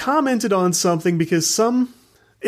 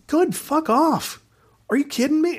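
mock exasperated retort; 9 semitones higher than usual. Retelling how he told the pompous guy off, high scornful retort. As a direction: throw it back at him with high-pitched disbelief.

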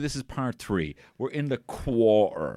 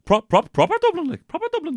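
much higher, loud mock of the wife's Dublin talk; imitating wife; 6 dB louder, faster than usual. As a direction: squeak it out like you're taking off your wife. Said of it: "That's how my wife talks".

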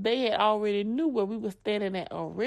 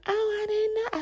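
daughter's innocent voice; 10 semitones higher than usual. Retold or imitated. Daughter's meek reply.

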